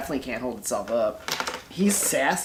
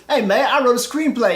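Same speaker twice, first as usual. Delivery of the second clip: higher, louder, eager pitch; eager Uber driver; 8 semitones higher, 6 dB louder than usual. Retold or imitated. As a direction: pitch your screenplay to a passenger. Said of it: "he turns to you and goes".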